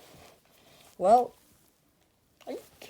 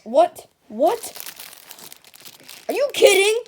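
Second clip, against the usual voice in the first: shocked, rising, loud; can't believe the apples are gone; outraged disbelief; 8 semitones higher, 7 dB louder than usual. Acted. Customer gasps at cashier, shocked and loud.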